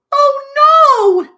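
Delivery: high, very loud "oh no" wail; groaning wail. Acted. Betty plunged into darkness, loud high groaning wail. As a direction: wail it out in high-pitched dismay. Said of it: "groaned Betty".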